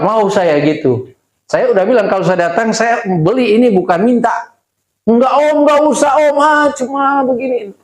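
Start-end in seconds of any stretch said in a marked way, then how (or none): 5.0-7.7 high-pitched, protesting refusal; insistent vendor. Retold or imitated. Congregant refusing the pastor's money for water, high and insistent. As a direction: wave off the payment in a high voice.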